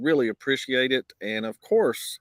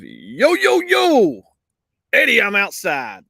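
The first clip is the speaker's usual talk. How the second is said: hyped street greeting; 7 semitones higher, 8 dB louder, slower than usual. Pretend. Reading a viewer's greeting to the chat, hyped and loud. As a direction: voice jumps much higher and louder on the middle yo.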